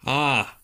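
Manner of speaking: high, loud open-mouthed yawn. Real, not acted. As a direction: let out a big late-night yawn. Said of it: "yawning, it's late here".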